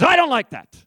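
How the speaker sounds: high, loud flat refusal from the driver; curt objection. Retold or imitated. Preacher voicing the taxi driver, high and blunt. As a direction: mimic the taxi driver balking.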